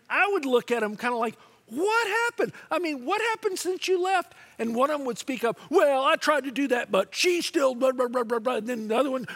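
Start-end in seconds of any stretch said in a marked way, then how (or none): 1.7-4.3 acted disbelief. Acting baffled counselor facing returning couple. 5.7-8.6 loud, raised-pitch mock complaint; hammed-up defensive griping; mock bickering spouse. Mimicking bickering spouse's excuse.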